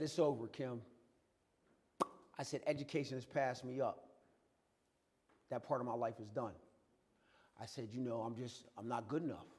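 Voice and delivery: soft, defeated retelling of a tearful bedside confession; tearful despair. Retold or imitated. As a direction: tell your wife you're done, quietly giving up. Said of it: "I'm in bed, and I'm crying".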